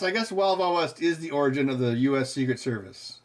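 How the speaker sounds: ordinary talk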